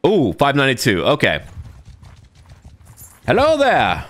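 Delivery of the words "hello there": high put-on soldier voice; playful warrior voice. Voicing his soldier greeting the enemy, high hammed-up voice.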